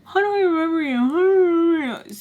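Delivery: high, whiny mocking imitation of him; imitating him. Mocking the ex's pestering question, high and whiny. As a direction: mimic his needy repeated question. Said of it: "how he constantly was like".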